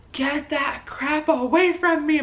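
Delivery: high, drawn-out mock exasperation; exasperated outburst. Labouring woman rejects the hypnobirthing track, exasperated. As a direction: act out being fed up, pitched well up. Retelling her snap during labour pains.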